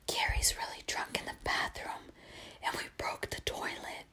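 secretive hushed confession; voiceless, barely audible whisper; retold whisper. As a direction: whisper so nobody else hears. Cousin secretly confessing to sleepy aunt.

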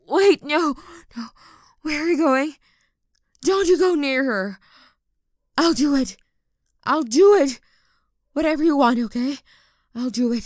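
loud, high, urgent pleas; desperate shout. Acted. Begging him to stay away from her, shouting. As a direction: shout to stop him leaving.

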